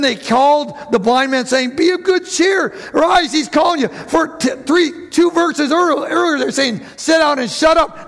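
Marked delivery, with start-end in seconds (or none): 1.8-3.9 high, lively cheering-on; rally him with a raised, high voice; encouraging call. A narrator's reading. Crowd calling the blind man forward, encouraging. 7.0-8.1 high mock shout; crowd's scolding. Fickle crowd telling off the beggar, mock shout.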